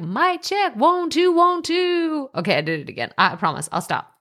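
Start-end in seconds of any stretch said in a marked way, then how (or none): 0.0-2.3 higher, hammed-up DJ mic check; mock radio DJ mic check. Podcaster pretending to be a radio DJ, hammed-up mic check. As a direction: play at being a radio DJ testing the mic. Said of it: "pretending like I'm a radio D J".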